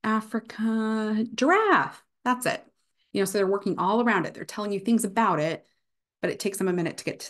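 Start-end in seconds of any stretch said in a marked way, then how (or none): 0.0-2.6 lightly acted word search, pitch higher; halting list of clues, then a pleased high giraffe; aphasic patient searching for a word. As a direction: circle around the word until it clicks. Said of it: "circumlocution is something that's also seen".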